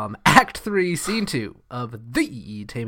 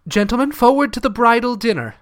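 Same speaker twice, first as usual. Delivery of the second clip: raised, projecting dramatic reading; higher-pitched theatrical verse; Shakespearean stage delivery; 6 semitones higher, 4 dB louder than usual. Acted. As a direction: declaim it to the whole wedding party. Performs Kate's lines from the play.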